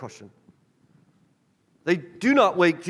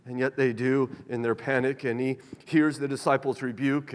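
ordinary delivery

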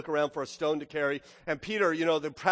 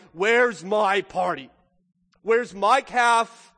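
loud, slow, acted demand; furious older brother; 7 dB louder, much slower than usual. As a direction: hammer out each word as an indignant son. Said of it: "the older brother is furious".

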